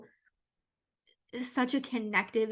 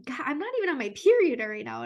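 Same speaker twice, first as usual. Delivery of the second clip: higher and louder, a fast embarrassed burst through tears; teary exclamation; 5 semitones higher, 6 dB louder, faster than usual. Real, not acted. Podcaster apologizes to listeners for crying, teary burst. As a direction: laugh at yourself while welling up. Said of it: "tearing up like a little baby".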